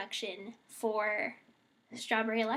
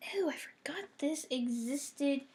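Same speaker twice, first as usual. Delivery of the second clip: whisper; 2 semitones higher, 7 dB quieter than usual. Real, not acted. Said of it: "whispered aside".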